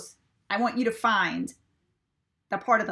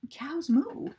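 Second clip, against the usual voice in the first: ordinary delivery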